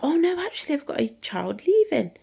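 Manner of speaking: high, loud, gushing delight; excited teacher. Retold or imitated. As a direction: squeal it out like thrilled news. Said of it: "she gets so excited".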